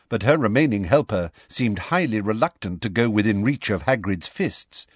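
plain reading voice

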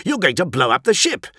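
high, heated accusation; angry accusation. A narrator's reading. Captain accuses engineer in quarrel.

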